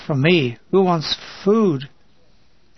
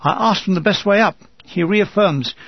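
usual speaking voice

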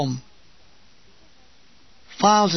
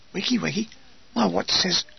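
soft, puzzled Wiggy, wiggy with a rise; sleepy echo; 6 dB quieter than usual. Acted. Woken soldier repeating the call, soft and puzzled. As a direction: mumble Wiggy, wiggy back as a question.

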